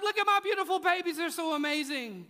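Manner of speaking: gushing social media post. Pretend. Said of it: "And, like".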